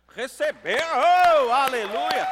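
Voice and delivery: full-force, high celebratory holler; big drawn-out welcome shout; jubilant shout. Real, not acted. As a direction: whoop up the congregation. Cheering visitors to congregation's applause.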